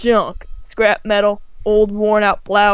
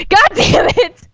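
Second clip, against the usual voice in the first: frustrated outburst; 12 semitones higher, 4 dB louder, slower than usual. Real, not acted. Reader flubs the line.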